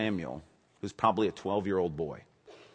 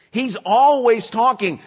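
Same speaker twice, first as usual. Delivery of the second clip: "always" stretched slow and loud; stress on always; 13 dB louder, slower than usual. Shown as a demonstration. Pastor insisting God speaks, stressing "always". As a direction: lean hard on "always". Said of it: "stress on always".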